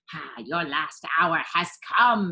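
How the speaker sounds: hissing villain. A narrator's reading. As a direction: gloat over the victim. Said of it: "he hissed".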